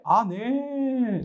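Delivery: high, long-drawn startled "네"; surprised reply. Retold or imitated. Student startled by a call from the pastor, surprised. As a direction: stretch it out high, as a taken-aback kid. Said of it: "이렇게 놀라는 거예요".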